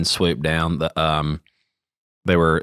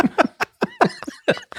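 high laugh; 6 dB quieter than usual. Real, not acted. Crows hunting you joke.

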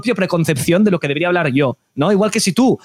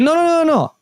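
higher-pitched rapid-fire mock objection; retold insistent correction; 10 semitones higher than usual. Organisers mixing up the two casters, mock insistent correction. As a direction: imitate organisers hurriedly setting it straight.

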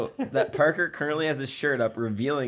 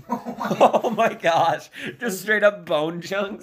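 higher, laughing disbelief; 6 semitones higher, 4 dB louder, much slower than usual. Real, not acted. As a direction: laugh out the words slowly. Laughing at calcium on cereal.

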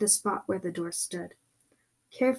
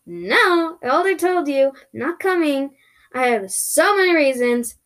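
higher, louder arguing; exasperated refusal; 5 semitones higher, 5 dB louder than usual. Acted. Refusing the voice that keeps saying come, exasperated. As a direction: push back insistently. Argues with the creature.

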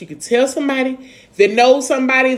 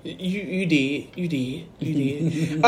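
dry murmur; 7 semitones lower, 7 dB quieter, much slower than usual. Real, not acted. Dry agreement.

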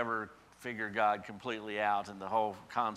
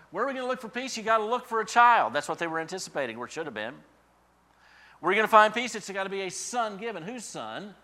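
much higher and louder rhetorical questions; fervent preaching; 9 semitones higher, 10 dB louder than usual. Acted. Preacher pressing the congregation, fervent and loud. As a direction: drive the questions home.

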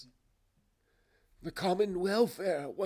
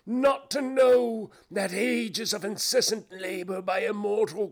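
high, loud ghostly lament; anguished cry; 13 semitones higher, 10 dB louder than usual. Acted. Phantom bewails its misused life to Scrooge, crying out. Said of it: "cried the phantom".

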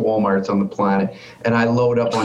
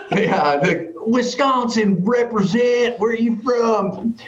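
mock Vegas patriots; 9 semitones higher than usual. Voicing Vegas locals cheering.

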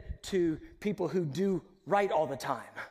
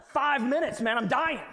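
hammed-up impatience, higher and louder; mock exasperation; 6 semitones higher, 5 dB louder than usual. Impatient person complaining about a short wait, hammed-up. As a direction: whine like waiting is torture.

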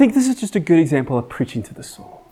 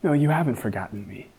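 quiet reassurance; 5 dB quieter than usual. Retold or imitated. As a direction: gently remind yourself. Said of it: "I remind myself".

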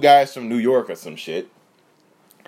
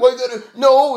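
sudden high, loud protest; loud retort; 10 semitones higher, 5 dB louder than usual. Retold or imitated. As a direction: fire it back loud and high.

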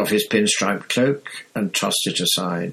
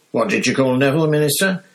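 high eager question; higher, louder excited ask; 6 semitones higher, 4 dB louder, faster than usual. Acted. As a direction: lean in, thrilled to know. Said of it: "he said excitedly".

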